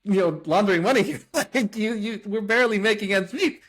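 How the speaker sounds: retelling a plea to the bankers, high and exasperated. Retold or imitated. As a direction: insist you're no money launderer, half laughing in disbelief. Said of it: "we told our bankers, like".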